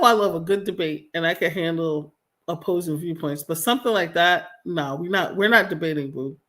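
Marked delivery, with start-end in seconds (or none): none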